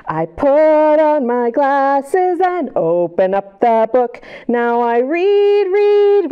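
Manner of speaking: slow, lilting read-aloud rhyme; slow poem reading. Acted. Storyteller reading the opening rhyme slowly to children. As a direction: read it like a gentle rhyme for kids.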